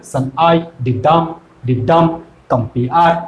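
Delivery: "shall I, ডিডাম, compare" low and slow, second beat of each foot stressed; weight on I, ডাম and -pare in "shall I, ডিডাম, compare"; iambic stress. Shown as a demonstration. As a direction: tap out "shall I, ডিডাম, compare" as weak-strong pulses. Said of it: "বোঝো কোথায় উচ্চারণ".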